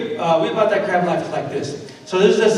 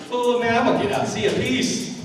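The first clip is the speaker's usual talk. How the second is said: lightly acted cocky farewell, pitched up; a hint of a smug escapee, higher voice; cocky crab; 7 semitones higher than usual. Boasting crab climbs out.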